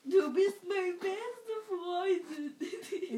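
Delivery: put-on slow, wailing drunk voice; drunk crying.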